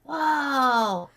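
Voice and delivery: high, delighted gasp of wonder; a child dragon awed by a trick; amazed whoa. Acted. The tiny dragon child reacts to the voices, high amazed whoa.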